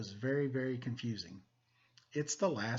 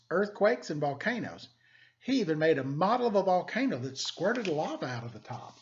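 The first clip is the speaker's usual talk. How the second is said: lively, expressive picture-book reading; storybook narration for students; reading to children; 3 semitones higher, 4 dB louder than usual. Acted. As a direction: read it like story time for the class. Teacher reads picture book aloud.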